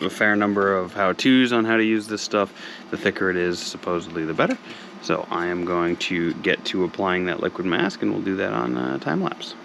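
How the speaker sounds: ordinary talk, nothing marked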